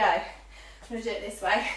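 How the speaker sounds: ordinary delivery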